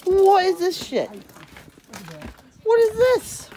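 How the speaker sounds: high, drawn-out shocked question; real disbelief at the ice. Real, not acted. Shocked by iced-over porch.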